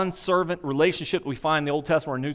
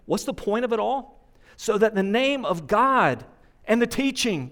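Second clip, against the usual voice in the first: higher, rising sermon climax, pressing each phrase; preaching at full force; 5 semitones higher than usual. Acted. Preacher drives the main point home to the congregation. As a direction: build the congregation up toward the point.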